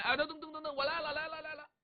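high, hurried answering call; hurried reply. Acted. Husband answers wife's call from the yard, high and hurried. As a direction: rush to answer the wife. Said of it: "一听屋内老婆喊".

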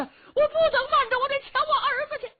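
very high, fast, loud protest; panicked mother's high-pitched rush; frantic old mother. Acted. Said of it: "可把老太太急坏了".

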